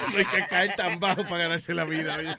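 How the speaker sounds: laughing while repeating it. Real, not acted. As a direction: laugh through the words. Repeats the quote, laughing louder.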